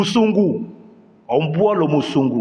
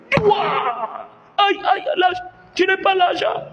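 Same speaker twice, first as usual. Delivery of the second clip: very high, far-off cry of pain; slap and cry. Acted. Slapped migrant crying out that he has no money. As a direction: yelp after the slap across the eyes.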